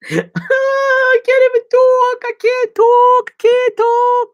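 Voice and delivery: very high, loud slurred wail; hammed-up tongue-tied yelling; Sylvester Stallone. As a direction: howl it out, stretched and garbled.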